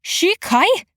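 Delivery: high rising shocked blurt. A narrator's reading. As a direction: blurt the name in disbelief. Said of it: "Aranya blurted".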